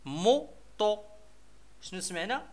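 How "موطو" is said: "موطو" in two separated beats, mo, to; split into syllables. Shown as a demonstration. Teacher sounding out the second picture for children, "موطو" syllable by syllable. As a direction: break "موطو" apart, syllable by syllable. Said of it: "غادي نشوفو لي سيلاب اللي كيتكونوا منهم".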